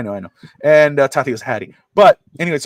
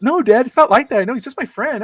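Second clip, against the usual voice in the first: put-on high, insistent kid protest; daughter's denial; 10 semitones higher, 4 dB louder than usual. Daughter denying a crush to Dad, high insistent voice. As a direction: imitate a daughter brushing off Dad. Daughter denies liking the boy.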